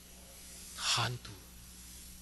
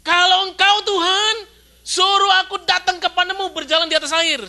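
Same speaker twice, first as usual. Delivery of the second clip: higher, louder daring challenge; bold Peter; 10 semitones higher, 5 dB louder than usual. Retold or imitated. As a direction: challenge Jesus boldly. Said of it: "kita bakal ngomong gitu nggak?". (Petrus menantang dengan berani, lebih keras)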